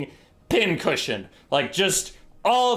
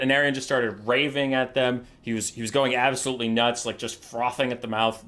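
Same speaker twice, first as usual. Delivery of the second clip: ordinary talk, nothing marked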